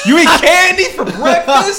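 high, loud incredulous outburst; laughing disbelief. Real, not acted. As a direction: blurt it through laughter, scandalised. Laughing mock outrage at cohost.

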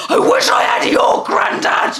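put-on high, drawn-out childish cry; slow, hammed-up envious shout; audible phone wail. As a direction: re-enact an ungrateful child wailing enviously. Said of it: "on the phone, audibly".

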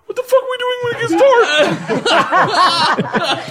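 very high, fast, squealing with laughter; laughing disbelief. Retold or imitated. Asking Igor about the night plan, squealing high with laughter. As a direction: squeak out the question in shock. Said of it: "I'm like".

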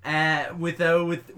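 usual speaking voice

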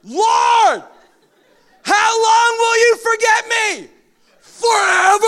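high, loud anguished shouting; frustrated psalm. Acted. Preacher voicing David's frustration at God, anguished shouting. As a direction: cry it out from a cave. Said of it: "This is how it was written".

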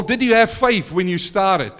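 ordinary delivery

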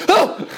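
sudden high squeaky "Oh!"; startled yelp. Shown as a demonstration. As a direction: squeak as if nibbled. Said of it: "Occasionally, like".

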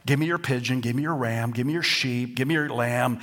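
ordinary talk

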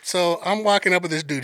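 ordinary talk

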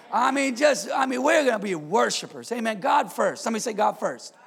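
rally the church to worship; preaching. Acted. Pastor urges the congregation to be worshipers, preaching hard.

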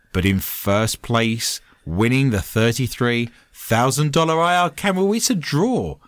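show host voice. Acted. Show host sign-off read.

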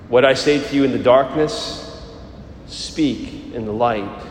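solemn liturgical proclamation; Gospel reading. Acted. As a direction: proclaim it like scripture at Mass.

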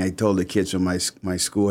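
ordinary delivery

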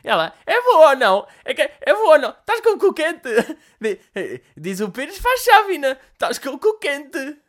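high, loud granny squeal; granny pushing her joke; high shrieking mock-granny; excited old woman. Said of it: "uma velha desdentada".

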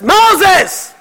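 high-pitched call, acting out God yelling; mock yell. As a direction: yell the name to get his attention. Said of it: "have to yell at us".

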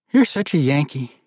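a touch of playful grumbling; playful mutter. A narrator's reading. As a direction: tease him lightly. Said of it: "she muttered playfully".

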